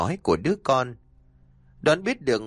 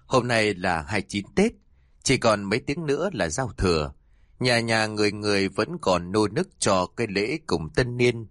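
ordinary delivery, nothing marked

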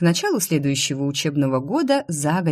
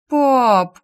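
pleading; 7 semitones higher, 6 dB louder than usual. Acted. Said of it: "сделала я жалостливые глазки".

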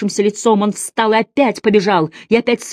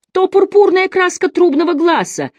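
a prophetic outburst, pitched high; high exclamation; 12 semitones higher, 3 dB louder than usual. A narrator's reading. The physician proclaims the trumpet's colour in a high awed cry. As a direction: cry it out much higher, awestruck. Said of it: "воскликнул мессир Салимбени".

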